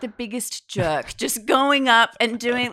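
cringe out loud at a near-miss embarrassment; mortified. Real, not acted. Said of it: "how mortifying".

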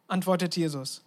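ordinary talk, nothing marked

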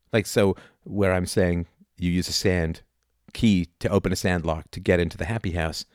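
ordinary talk

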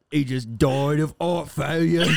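hammed-up Cockney announcement; Arthur. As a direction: break bad news in thick Cockney. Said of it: "tell a family that their dad just died".

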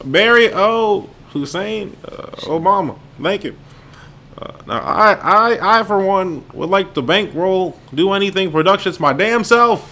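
high, loud, lightly voiced Obama; speechy Obama voice, slightly put on; presidential impression.

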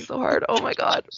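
choking on water while laughing; swallowed wrong way. Real, not acted. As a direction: cough through it, laughing. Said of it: "I'm choking on my water".

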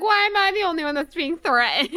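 high, loud whiny protest; hammed-up wounded outcry; mock wail. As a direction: imitate an indignant tearful complaint. Mimicking Tracy's wailing complaint.

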